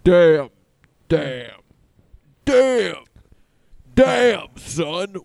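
loud, punchy repeated damn; loud catchphrase. Pretend. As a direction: bark it out like a beat. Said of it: "Can you make that the whole beat?".

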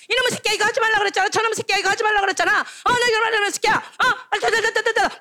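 high, rapid-fire nagging; nagging parent. Acted. Parent chasing a child around, shrill nagging. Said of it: "막 잔소리".

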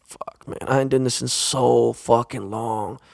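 slow, hushed whisper to himself; whispered dread. Retold or imitated. Dreading [name]'s big order, slow hushed whisper. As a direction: mutter it under your breath, slowly.

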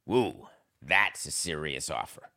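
ordinary delivery

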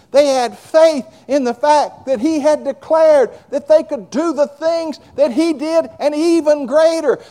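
loud, slow, fervent preacher's drive; preaching at full force. Acted. Preacher to congregation, fervent loud preaching. Preaching about faith in Jesus.

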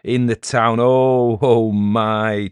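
loud, drawn-out scornful hooting; overcome with mirth. A narrator's reading. Elder overcome at the mention of the town, mirth. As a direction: savour the absurdity, nearly laughing. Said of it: "Charlesy was overcome".

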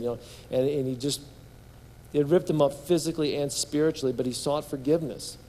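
ordinary talk, nothing marked

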